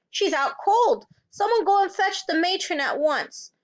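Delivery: high, urgent call for help; alarmed teacher taking charge; crying out. A narrator's reading. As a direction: send pupils running for help. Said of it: "she cried".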